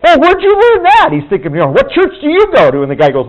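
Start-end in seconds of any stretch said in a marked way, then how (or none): 0.1-1.1 much higher and louder, delighted surprise; amazed question. Retold or imitated. Uncle asks the coworker where he learned it, high delighted surprise. 1.7-2.8 much higher pitched, curious and keen; eager question. Retold or imitated. Uncle wonders which church the man attends, eager and high.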